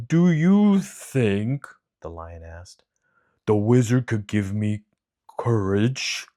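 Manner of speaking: slow, loud, timid big lion voice; a shy king of the beasts; cowardly lion. Acted. Lion character voice.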